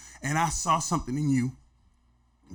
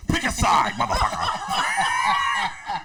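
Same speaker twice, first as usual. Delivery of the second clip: loud, high mimicked ultimatum; Papa Rick impression; 6 semitones higher, 4 dB louder, much slower than usual. Rick demanding friend side against Prince, shouted impression. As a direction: escalate into a drawn-out demand. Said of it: "he's like".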